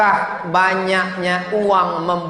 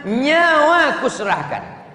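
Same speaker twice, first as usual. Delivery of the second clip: higher, louder, hammed-up devotion; mock romantic vow; 7 semitones higher, 3 dB louder than usual. Preacher plays a devoted husband, hammed-up vow. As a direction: swear it grandly, slowing at the end.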